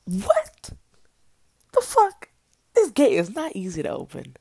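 loud, high-pitched incredulous outburst; outraged disbelief. Retold or imitated. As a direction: exclaim it loudly, acting out your disbelief. Acted disbelief at his excuse.

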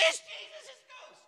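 tiny high squealed babble; very high, fading panicky squeal; mock panic. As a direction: squeak it out like flustered disciples. Disciples' panicked babble, acted.

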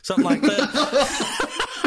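words swallowed by high, loud laughter; laughing. Real, not acted. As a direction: crack up mid-sentence. Laughing at own story.

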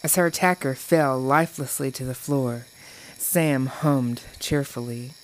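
ordinary delivery

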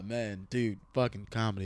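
ordinary talk, nothing marked

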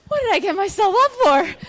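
high, loud, half-laughing panic; panicked self-mock. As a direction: squeal it at yourself in mock alarm. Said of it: "I was like".

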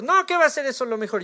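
quick, high-pitched mock objection; objecting. Believer argues back that God's plan isn't best, objecting. Acted argument with God.

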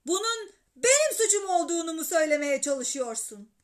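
loud, indignant father; shouted question. A narrator's reading. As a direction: fire back an accusation at full voice. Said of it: "diye bağırarak sordu babam". (bağırarak hesap sorma)

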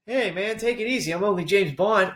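mock James Bond. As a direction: play Bond as a laid-back dude. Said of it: "what does that consist of".

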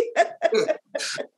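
airy, voiceless laughing; real breathy giggling; amused laugh. Real, not acted. Amused by the name mix-up.

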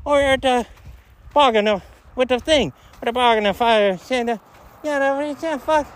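clowning around in a silly high voice; joking put-on voice. Clowning for a friend at camp, in a silly high voice. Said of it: "Quit trying to be funny".

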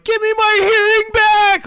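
high, loud drawn-out mock scream; imitated screaming. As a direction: scream it in imitation of a desperate victim. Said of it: "he's screaming".

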